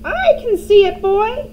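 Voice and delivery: high, loud crone reply; grandma shouting down to George; Grandma shouting back. A narrator's reading. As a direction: bark it back like an old hag. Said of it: "the old woman shouted back".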